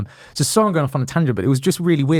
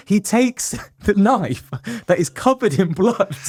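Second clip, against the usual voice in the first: slow, higher, fighting back laughter; laughing while telling; 7 semitones higher than usual. Real, not acted. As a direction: speak through giggles you can't hold in. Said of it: "I shouldn't laugh".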